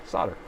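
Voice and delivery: "Solder" said sodder, no L sound, with a flat ah; "Solder" as "sodder", dropping the L; american solder. Shown as a demonstration. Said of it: "It just sounds better".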